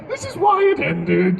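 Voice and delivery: high, laughing mock shout; mock outburst. Ex arguing with egotistical bard, mock outburst. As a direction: cry out high, laughing through it.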